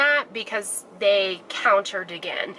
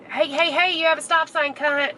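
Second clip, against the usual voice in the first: angry yelling; 7 semitones higher than usual. Real, not acted. Yelling at a driver.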